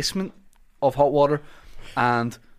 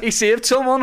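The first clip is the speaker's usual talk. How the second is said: impressed crowd; 10 semitones higher, 5 dB louder than usual. Retold or imitated. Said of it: "they were like".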